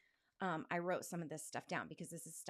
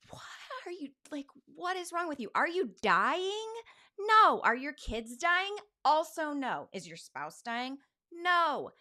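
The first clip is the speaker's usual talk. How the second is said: much higher and louder, berating herself; self-scolding inner voice; 8 semitones higher, 9 dB louder than usual. Retold or imitated. Inner voice berating her for feeling unhappy, shouting. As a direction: yell at yourself like a harsh inner critic.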